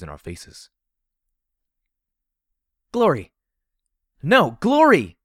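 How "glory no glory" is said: desperate high, loud scream. Acted. Heroine screams after her friend as the door closes, screaming. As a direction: scream it out at full force. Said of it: "I screamed as I fired".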